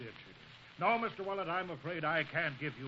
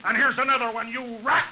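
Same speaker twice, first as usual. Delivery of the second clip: loud, high, furious yell; furious shout; 9 semitones higher, 11 dB louder than usual. Acted. Cutler hitting the crook, furious shout. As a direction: bellow it as he lands the punch. Cutler hits Chiggers again.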